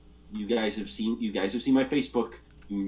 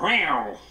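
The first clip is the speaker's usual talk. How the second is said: sudden yowling meow; imitated cat yowl; 3 semitones higher than usual. Telling friends about his own cat's response, imitated yowl.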